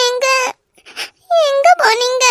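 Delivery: crying. Acted. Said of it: "ஏய், ஏன் அழுற?".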